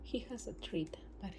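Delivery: whispered aside. Real, not acted. Owner whispering about her jealous bird. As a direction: whisper it so the bird won't hear.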